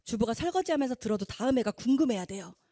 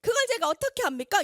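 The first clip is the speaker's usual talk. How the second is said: higher, louder melodramatic protest; morning drama tone; 9 semitones higher, 6 dB louder than usual. Retold or imitated. Mother defying the judge in a melodramatic soap tone. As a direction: play the defiant soap-opera wife. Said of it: "아침 드라마 톤으로".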